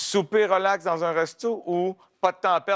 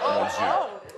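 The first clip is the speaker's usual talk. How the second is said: usual speaking voice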